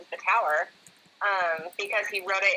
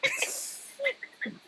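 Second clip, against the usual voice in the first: higher voice, full of laughter; laughing hard; 9 semitones higher than usual. Real, not acted. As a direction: agree while cracking up. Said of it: "I'm laughing so hard".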